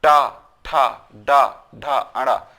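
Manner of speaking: टा, ठा, डा, ढा, अड़ा punched with tongue on hard palate; drawn-out demo of टा, ठा, डा, ढा, अड़ा as retroflex sounds; retroflex consonant drill. Shown as a demonstration. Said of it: "मूर्धा का प्रयोग हुआ".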